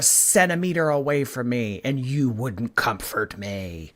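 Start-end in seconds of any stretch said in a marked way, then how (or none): none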